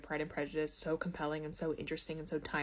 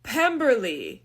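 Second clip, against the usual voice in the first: lightly acted, slow, loud scene announcer; mock stage announcement; 5 semitones higher, 6 dB louder, slower than usual. Imitating Mary announcing the set change to the audience, mock-solemn announcement. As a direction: announce the place name, drawn out and higher. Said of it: "straight face, walks out from the shadows".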